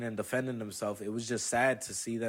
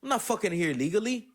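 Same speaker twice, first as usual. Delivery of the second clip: much higher, louder angry protest; getting loud; 10 semitones higher, 5 dB louder than usual. Retold or imitated. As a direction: yell back at her in outrage. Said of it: "that's when I started getting loud".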